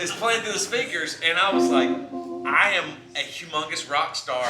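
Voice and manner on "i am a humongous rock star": mock rock-star boast. Mock rock-star bragging to crowd.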